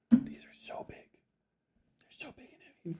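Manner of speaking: hushed whisper. Real, not acted. Streamer marvels at the dog's treats, whispering.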